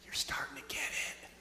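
whispered hint, barely voiced; Jesus leaning in to say it under his breath; subtle whisper. Jesus hinting to the crowd in a whisper.

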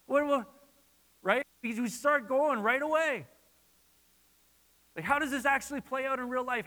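a hint of panic climbing; anxious objections. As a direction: voice the crowd's nervous what-ifs. Voicing listeners' anxious objections.

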